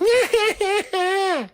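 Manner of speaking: irritating high cackle; imitated laugh, very high and loud; Tom Cruise laugh. Mimicking Steve's laugh from The Outsiders, irritating high cackle. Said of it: "that irritating high voice and that high laugh".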